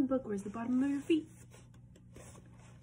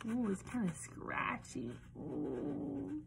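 ordinary delivery, nothing marked

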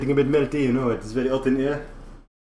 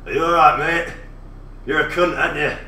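loud, brash British bloke accent, a mock Frenchman's try; Tom impression; 6 dB louder than usual. A French streamer doing his friend Tom for chat, loud British bloke accent. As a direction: bark it out like a cheeky English lad. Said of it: "I will try the impersonation of Tom now".

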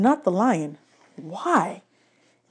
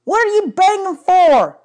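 much higher, louder call from outside; shouting back; 13 semitones higher, 8 dB louder than usual. A narrator's reading. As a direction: yell through the trailer wall. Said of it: "shouted back".

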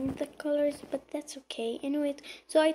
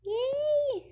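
high-pitched squeal of joy; happy cheer; 12 semitones higher than usual. Pretend. Cheers, says super happy.

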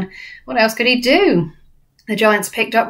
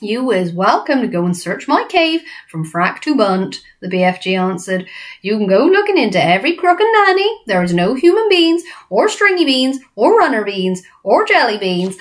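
the BFG; 2 semitones higher, 3 dB louder than usual. Acted. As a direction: brush off the search with friendly cheek. BFG figure voice.

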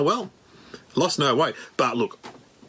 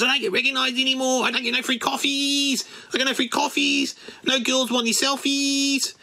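higher whiny mate voice; imitating Husey; 10 semitones higher than usual. Comic imitates famous friend complaining, in a higher whiny voice. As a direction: whine about losing fame, pitched up. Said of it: "He goes".

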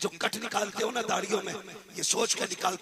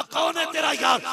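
high, loud demand from the father; 10 semitones higher, 5 dB louder than usual. Acted. Father demands of his son, a loud high question.

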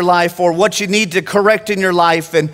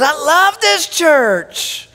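put-on a slow, higher, sweet old lady's voice; lightly voiced sweet old lady; 7 semitones higher, much slower than usual. Preacher quotes Miss Ruth praising the church, lightly voiced sweet old lady. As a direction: ease into a gentle elderly lady, slowly. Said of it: "And she says".

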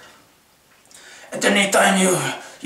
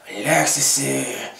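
lower, softer and slow, settling down; calming relax; 5 semitones lower, 4 dB quieter than usual. Shown as a demonstration. Talking himself down after the rage, low and slow. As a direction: ease off, soothe it out slowly. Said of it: "gotta calm yourself down".

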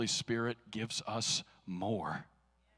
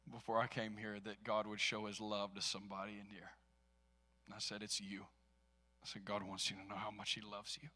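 tender hush; 4 semitones lower, 10 dB quieter than usual. Retold or imitated. Tender words to store lady.

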